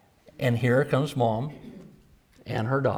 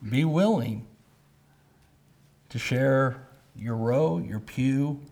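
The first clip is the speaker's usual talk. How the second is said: ordinary talk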